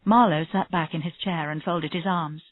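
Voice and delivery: ordinary delivery, nothing marked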